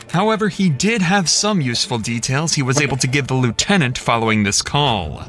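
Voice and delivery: ordinary talk, nothing marked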